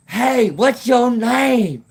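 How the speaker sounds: high, loud drawn-out holler; imitated long-distance yell; calling out. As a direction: call across a field, stretching the words. Reenacting yelled exchange across a field.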